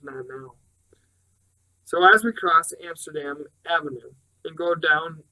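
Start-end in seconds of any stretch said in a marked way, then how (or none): 0.0-0.6 hushed, much softer warning; whisper. A narrator's reading. Robert hushes her on the street, soft whisper. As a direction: breathe it so no one overhears. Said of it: "he whispers".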